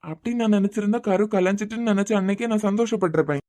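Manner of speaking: normal talking voice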